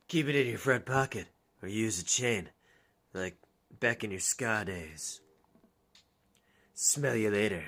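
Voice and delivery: lightly voiced low, teasing tough guy; Robert. Voices Robert teasing, then leaving.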